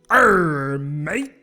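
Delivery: loud hammy pirate arr; his best try at a pirate. Acted. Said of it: "let me see if I can do my best pirate voice".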